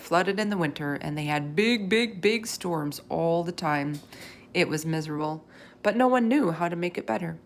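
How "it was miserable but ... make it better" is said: plain reading voice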